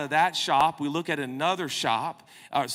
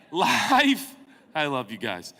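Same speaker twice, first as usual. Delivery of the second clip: usual speaking voice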